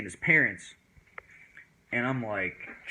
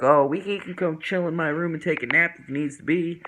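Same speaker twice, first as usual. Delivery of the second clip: higher, louder put-on friend voice; imitated female friend; 6 semitones higher, 4 dB louder than usual. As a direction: mimic her offering a solution. Retold female friend answering.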